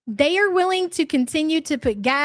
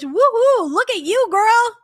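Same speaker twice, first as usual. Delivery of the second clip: excited cheer; 8 semitones higher, 5 dB louder than usual. Real, not acted. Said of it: "Accepted to college".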